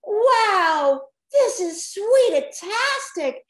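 high, loud delighted squeal; happy shriek. A narrator's reading. Kids on a candy mountain, squealing with delight. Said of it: "shrieked with happiness".

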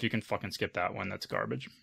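ordinary delivery

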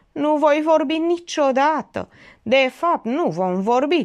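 high, theatrical vow of silence; grandly pathetic. A narrator's reading. Witness refuses to speak without permission, grandly pathetic. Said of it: "declară patetic".

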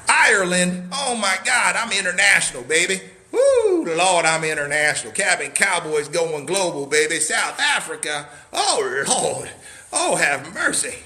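loud, high, thrilled over viewers from abroad; excited shout-outs. Real, not acted. Cabin seller hyping his global viewers, thrilled and loud. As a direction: hype it up like the whole world tuned in.

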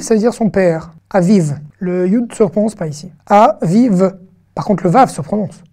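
usual speaking voice

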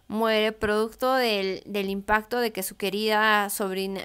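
usual speaking voice

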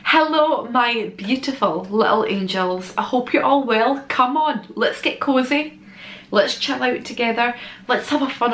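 self-help influencer. Acted. Influencer greeting to viewers.

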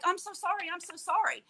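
high, repeated retold apology; frantic apology. Wheelchair user apologising to people she hit, high and pleading.

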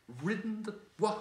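high, loud whinnying cry; angry horse neighing the words. Acted. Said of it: "it came out as a sort of neigh".

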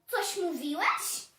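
loud, drawn-out question rising high; threatening challenge. Acted. Hedgehog to the dog, loud slow challenge. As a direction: slowly dare the other to repeat it.

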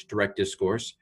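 ordinary talk

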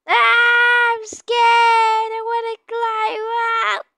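high, drawn-out mock wail; playful scared wail. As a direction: wail it slowly in hammed-up fear. Scared of werewolf in game.

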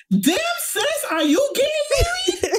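a laughing squeal of surprise at a friend crying; shocked high squeal. Retold or imitated. Teasing her sobbing friend at the wedding, high laughing squeal. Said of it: "I was just like".

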